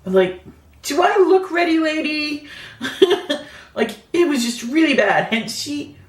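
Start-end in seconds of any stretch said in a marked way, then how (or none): 0.8-2.5 much higher, louder, sarcastic retort; sarcastic squeal. Retold or imitated. To the nurse asking if she's ready, a high sarcastic retort. 2.5-3.4 breathy laugh. Real, not acted. After her retort.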